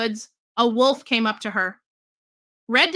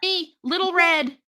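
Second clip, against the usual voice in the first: put-on higher, slow child voice; a lightly voiced girl calling; little girl voice; 6 semitones higher than usual. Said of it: "she called out".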